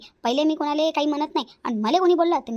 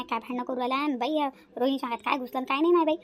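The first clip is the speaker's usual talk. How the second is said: normal talking voice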